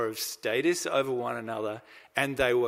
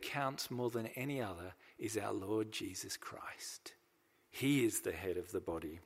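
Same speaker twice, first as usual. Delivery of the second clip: whispered awe; 4 semitones lower, 10 dB quieter than usual. Real, not acted. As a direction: drop to a hush for the holy name. Reverent climax of sermon point.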